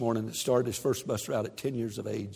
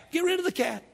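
high quip; faster than usual. Acted. Punchline after mock whine.

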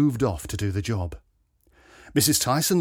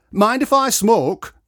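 higher, louder, slow request; Robbie asking; 10 semitones higher, 7 dB louder, slower than usual. A narrator's reading. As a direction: ask it drawn out and hopeful. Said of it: "asked Robbie".

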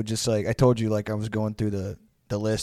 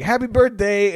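higher, chirpy imitated greeting; mock well-wisher; 8 semitones higher, 4 dB louder than usual. Unknowing friend greeting dad, mock-cheery higher voice. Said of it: "And they were like".

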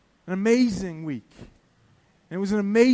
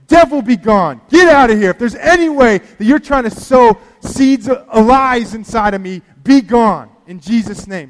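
commanding rebuke; 2 semitones higher, 8 dB louder than usual. Retold or imitated. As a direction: order the devil out with full force. Retold rebuking the devil aloud.

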